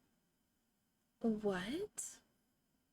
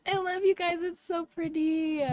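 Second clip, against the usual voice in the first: higher, drawn-out happy gush; tearful affection; 6 semitones higher than usual. Real, not acted. Streamer to her engaged friends, tearful affection. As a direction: gush fondly, close to tears. Moved, gushing to friends after laughing.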